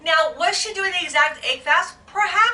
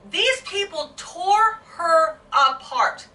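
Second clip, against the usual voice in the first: slow, tearful, voice catching; fighting back real tears; choking up; 3 semitones higher, much slower than usual. Real, not acted. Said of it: "how emotional I sound".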